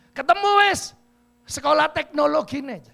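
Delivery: high, loud excited shout. Retold or imitated. Woman shouting out on spotting the campus. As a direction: yell out on finding the place. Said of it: "ada sing teriak".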